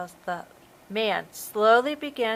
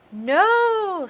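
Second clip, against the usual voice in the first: defiant shout; 11 semitones higher, 8 dB louder than usual. A narrator's reading. Girl refuses the shark.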